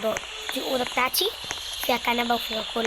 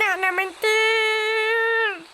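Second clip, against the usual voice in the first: awed; 7 semitones higher, much slower than usual. Real, not acted. Balloon lifting off.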